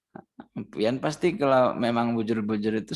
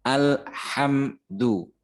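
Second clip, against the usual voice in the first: Alhamdu stretched out very slowly; slow teaching read of Alhamdu; slow drawn alhamdu; much slower than usual. Shown as a demonstration. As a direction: draw out each syllable of Alhamdu. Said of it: "Oke, bisa ya".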